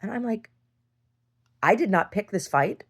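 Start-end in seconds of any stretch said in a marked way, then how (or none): 1.6-2.8 defiant yell. Retold or imitated. Retelling a fight, defending herself.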